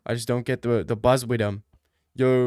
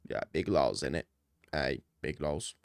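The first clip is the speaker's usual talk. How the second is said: mock deep voice; 11 semitones lower, 10 dB quieter than usual.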